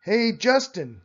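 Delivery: high, loud call across the playground. Acted. Amelia calling Justin from the tetherball court, loud and high. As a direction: call his name from far off.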